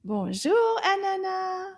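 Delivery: loud, bright greeting called out; loud hello to a friend; nice loud voice. Shown as a demonstration. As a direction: call the greeting out loudly to the puppet. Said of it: "in a nice loud voice".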